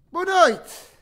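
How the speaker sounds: loud, high-pitched good-evening call; booming greeting. Pretend. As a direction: belt out a greeting to children. Loud greeting for children to repeat.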